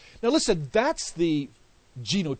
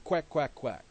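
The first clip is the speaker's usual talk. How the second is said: put-on baby's quack. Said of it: "out of her mouth came".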